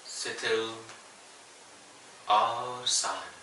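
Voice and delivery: unhurried yoga nidra guidance with long pauses; guided meditation. Real, not acted. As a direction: guide them gently into stillness, phrase by phrase. Leads yoga nidra relaxation. (ठहर-ठहर कर योग निद्रा का निर्देश)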